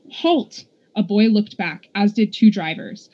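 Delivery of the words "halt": high call at ordinary volume; narrated mock holler; restrained yell. A narrator's reading. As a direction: pitch it up like a shout, held back. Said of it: "yelled at the top of her lungs".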